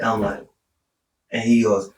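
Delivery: ordinary talk, nothing marked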